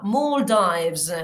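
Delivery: Maldives repeated as a clean model; model pronunciation. Shown as a demonstration. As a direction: Maldives with the long O. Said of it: "esa es una O larga".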